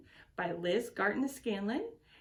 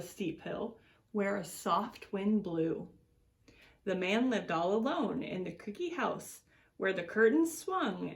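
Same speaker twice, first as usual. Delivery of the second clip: put-on reading to children. A narrator's reading. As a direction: read it like story time for little ones. Reads picture book to children.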